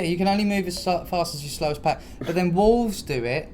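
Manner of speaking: normal talking voice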